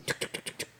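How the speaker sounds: pasos susurrados sin voz; imitando pasos. Retold or imitated. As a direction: chasquidos suaves como pasos. Imita pasos del vecino. (pasos imitados en susurro)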